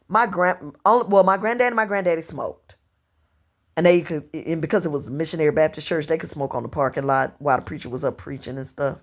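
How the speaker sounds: ordinary delivery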